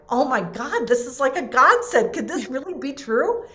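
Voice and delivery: high, loud, thrilled disbelief; excited gasp. Retold or imitated. Recalling the trainer call, thrilled and loud. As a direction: gush like you just got your dream offer.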